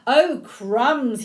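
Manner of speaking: a touch of mock fright for children; mildly startled storybook exclamation; storybook alarm. A narrator's reading. Snake alarmed at seeing Gruffalo.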